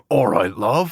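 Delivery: Jason Statham line. Retold or imitated. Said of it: "every single one of his lines start with".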